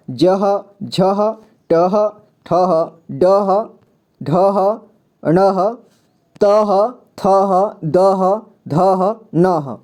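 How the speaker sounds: जह to नह slowly, each letter followed by a separate "ha"; slow teacher's demo of जह as "ja-ha", and so on to नह; syllable drill. Shown as a demonstration. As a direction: chant out जह, झह, टह one by one with pauses. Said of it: "तो इसे पढ़ेंगे चह".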